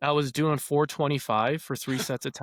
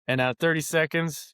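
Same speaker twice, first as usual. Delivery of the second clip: ordinary talk, nothing marked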